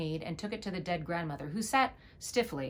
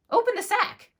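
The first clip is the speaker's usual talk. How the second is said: loud, much higher shout; 9 dB louder than usual. A narrator's reading. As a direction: yell it out from the sack. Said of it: "little Klaus shouted".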